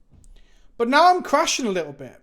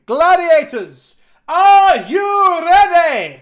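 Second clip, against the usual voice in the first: booming Scottish announcer, drawn out and much louder; Scottish accent; 10 semitones higher, 12 dB louder, much slower than usual. Acted. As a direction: roar it like a Scottish arena host. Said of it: "That's my Scottish accent".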